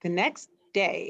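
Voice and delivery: plain reading voice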